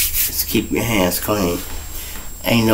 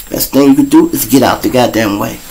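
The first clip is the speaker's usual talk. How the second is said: ordinary talk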